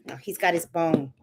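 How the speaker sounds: ordinary talk, nothing marked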